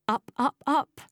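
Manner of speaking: breathless-sounding urgency, pitched high; frantic climbing. A narrator's reading. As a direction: scramble upward word by word. Still climbing in panic.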